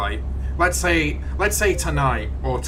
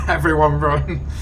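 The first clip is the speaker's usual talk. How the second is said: laughing; 4 semitones higher than usual. Pretend. Mock alarm at Riley's rage.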